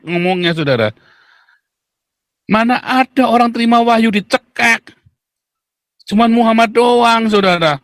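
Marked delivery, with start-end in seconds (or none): none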